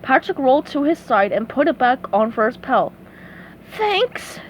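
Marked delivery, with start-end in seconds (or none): 3.7-4.4 high, thin wheeze; wheezing. A narrator's reading. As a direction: wheeze out weak gratitude. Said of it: "SpongeBob wheezed".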